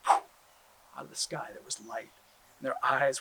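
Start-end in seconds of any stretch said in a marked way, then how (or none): none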